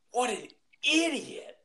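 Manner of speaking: high, drawn-out mocking movie quote; Chaz Reinhold. Quoting Wedding Crashers to a friend, high slow scoffing voice. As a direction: pitch it way up and stretch out "idiot". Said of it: "And Chaz goes".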